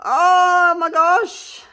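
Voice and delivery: high, loud, slow, drawn-out amazement; amazed. Real, not acted. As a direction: let it burst out in real wonder. Unveiling finished resin piece.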